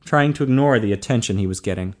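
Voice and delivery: ordinary delivery, nothing marked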